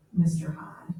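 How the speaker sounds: hushed whisper of the name. Acted. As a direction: breathe the name out secretly. Reading Hyde's savage snarl.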